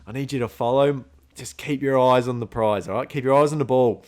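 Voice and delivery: rally the mouse hunt like quarter-time talk; mock coach pep talk. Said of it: "And I'm going".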